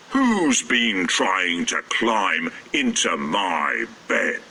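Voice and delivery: a heavy understated roar; mild roar. A narrator's reading. Father Bear checking his bed, slow low mock roar. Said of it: "roared Father Bear".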